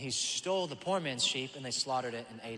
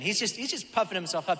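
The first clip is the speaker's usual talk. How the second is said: ordinary delivery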